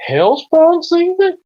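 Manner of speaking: high, loud, drawn-out disbelief; astonished cartoon double take; incredulous question. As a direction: echo it back in cartoonish shock. Cartoon season argument, startled question.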